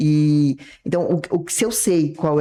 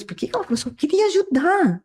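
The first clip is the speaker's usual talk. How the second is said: much higher, whimpering mock victim; hammed-up high victim whine; wounded victim; 12 semitones higher than usual. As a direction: play the hurt helper who got rejected. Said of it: "fui pra vítima".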